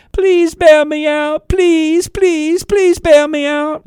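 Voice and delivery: high, stretched-out pleading whine; hammed-up plea for a handout; mock begging. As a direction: beg for a bailout like a whining kid. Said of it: "Don't come to government and say".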